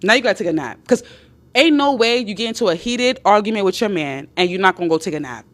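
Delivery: ordinary talk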